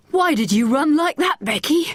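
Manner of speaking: high, breathless question; out of breath from running; gasped. A narrator's reading. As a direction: ask while still gasping for air. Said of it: "he gasped".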